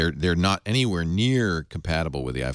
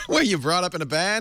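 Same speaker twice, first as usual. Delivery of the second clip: mock scolding parent; 10 semitones higher than usual. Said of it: "scolding quote about barn manners".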